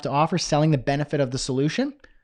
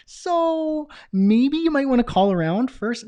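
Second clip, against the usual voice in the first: timid paramedic; 10 semitones higher, slower than usual. Acted. Timid paramedic turns patient away.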